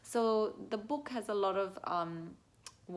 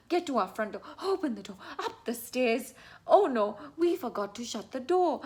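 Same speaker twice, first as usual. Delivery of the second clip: loud, raised-pitch alarm; playful breathless urgency; urgent storybook panic; 5 semitones higher, 9 dB louder than usual. Shown as a demonstration. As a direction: hurry home as if a bear is chasing. Fleeing the bear in the story.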